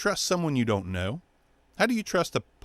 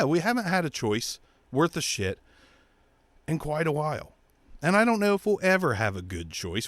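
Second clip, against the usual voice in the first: ordinary delivery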